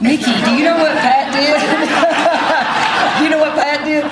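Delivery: real laughter through the words; can barely get it out for laughing. Real, not acted. Assistant coach retelling the funny part to the crowd, laughing.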